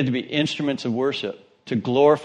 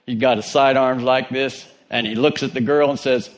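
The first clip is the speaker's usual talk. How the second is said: normal talking voice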